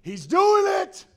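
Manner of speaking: high, loud exclamation; worked-up cheer from the crowd; excited crowd member. Acted. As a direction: shout it like an amazed onlooker. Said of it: "crowds are at this point like".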